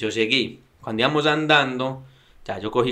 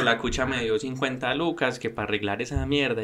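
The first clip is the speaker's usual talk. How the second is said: ordinary delivery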